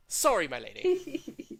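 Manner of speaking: slow, loud theatrical apology; bow with mock gallantry; mad scientist voice. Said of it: "he's like".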